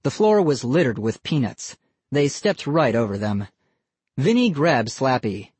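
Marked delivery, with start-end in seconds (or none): none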